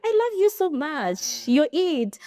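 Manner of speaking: very high, slow, syrupy declaration; hammed-up romantic gush; lovey-dovey partner. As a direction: swoon the words out to your babe. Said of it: "be like".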